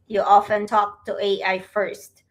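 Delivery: usual speaking voice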